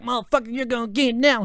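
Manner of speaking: high mock yell of a threat; retold angry threat. Burnt guy threatening the storyteller, retold angry threat. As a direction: imitate a furious pursuer, pitched high. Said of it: "screamed in pain, he was like".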